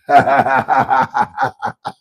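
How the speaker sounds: big mocking laugh out loud. Real, not acted. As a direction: laugh right at him. Laughing at the hater.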